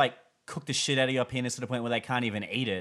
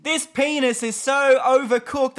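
raised, louder, slow mock complaint; mock chef rant; 12 semitones higher, 9 dB louder, slower than usual. Cohost keeps the chef bit going, mock indignation. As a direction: grumble like an outraged chef.

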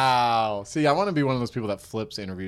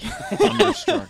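dramatic announcement; 7 dB louder, slower than usual. Pretend. Announcing superhero name.

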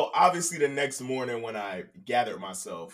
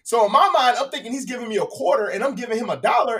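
usual speaking voice

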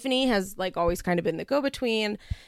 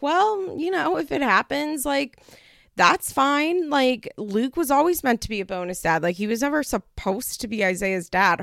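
put-on higher, breezy unbothered girl; breezy Kayla quote; 6 semitones higher, 4 dB louder than usual. Kayla brushing off her mom, breezy. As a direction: toss it off like it's no big deal. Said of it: "Kayla's like".

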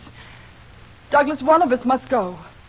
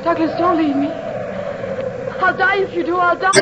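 desperate plea building to scream; 6 semitones higher than usual. Acted. Anne begs Douglas not to leave.

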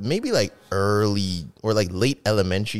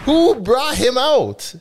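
incredulous; 5 dB louder, slower than usual. Real, not acted. Joking disbelief about [name].

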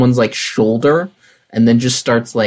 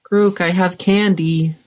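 higher, drawn-out singsong call; 5 semitones higher, slower than usual. Acted. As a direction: call out for someone lost in a crowd. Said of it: "calling out".

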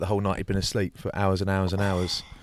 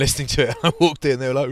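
normal talking voice

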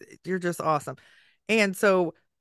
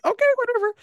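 much higher, exasperated mock whatever; 3 dB louder, much faster than usual. Parent gives in to stubborn daughter, exasperated. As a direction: throw up your hands. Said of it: "I'm like".

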